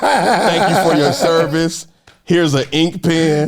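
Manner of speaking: slow, laughing mock thanks; sarcastic send-off, drawn out; mock farewell. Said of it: "no going away brunch".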